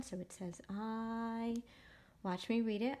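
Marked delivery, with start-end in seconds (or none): 0.7-1.5 "I" drawn out on a steady tone; long vowel sound. Shown as a demonstration. Teacher showing what the macron means, "I" held long. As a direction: hold "I" long, like eye. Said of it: "say a long vowel sound".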